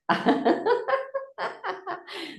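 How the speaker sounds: high rolling laugh; long high-pitched laughter. Real, not acted. Laughing about the India trip.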